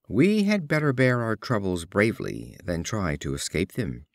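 usual speaking voice

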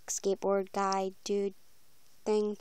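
ordinary talk, nothing marked

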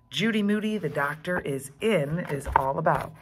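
usual speaking voice

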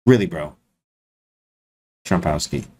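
mock-serious disbelief, ending in a drop; incredulous reaction. Streamer re-enacting what he says to opponents, incredulous. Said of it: "I literally say that".

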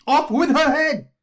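slow, high, full-blast Queen's shout; top-of-voice shout. A narrator's reading. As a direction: bellow the order high, stretching it out. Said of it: "shouted at the top of her voice".

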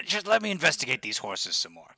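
ordinary talk, nothing marked

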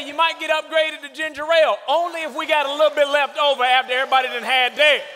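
ordinary delivery

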